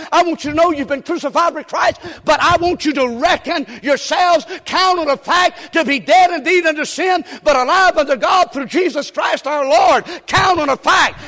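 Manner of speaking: loud, high proclamation; preaching at full force. Acted. Preacher voicing God's words to believers, full force. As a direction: proclaim God's command with fire.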